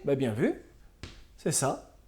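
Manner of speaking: ordinary talk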